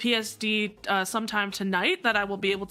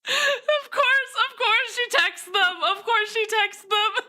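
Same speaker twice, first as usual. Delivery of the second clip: amused exclaim; 10 semitones higher than usual. Real, not acted. Laughing at character's line.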